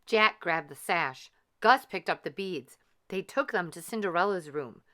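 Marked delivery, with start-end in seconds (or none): none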